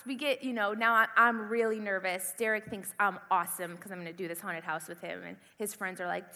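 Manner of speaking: ordinary delivery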